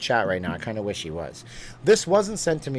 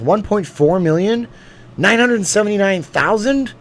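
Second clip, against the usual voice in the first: high, loud disbelief at a huge number; loud, incredulous and high-pitched; amazed; 8 semitones higher, 9 dB louder than usual. Real, not acted. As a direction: exclaim each figure as an astonished question. Amazed at view counts.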